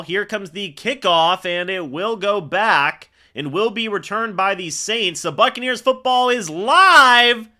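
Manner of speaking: loud, building kickoff call; big sportscaster voice, stretching the key words; live play-by-play. Real, not acted. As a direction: announce the kickoff with rising excitement.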